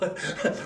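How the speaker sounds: breathy little gloating laugh. Acted. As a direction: chuckle to yourself at your cleverness. Gloating after hiding the blood.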